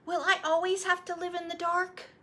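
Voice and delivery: put-on high, eager child voice; a little boy pleading for the story; young boy. Boy asking his grandfather to retell his birth, eager child voice.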